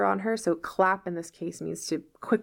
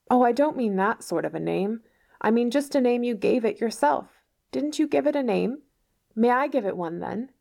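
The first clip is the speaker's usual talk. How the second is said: Anne voiced; 6 semitones higher than usual.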